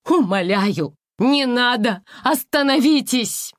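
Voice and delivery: high, loud frantic begging; desperate pleading shout. Acted. Mother begs captors desperately.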